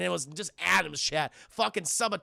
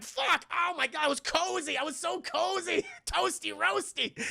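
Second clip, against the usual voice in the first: whiny alien voice; 8 semitones higher, 3 dB quieter than usual. Retold or imitated. Alien whining voice.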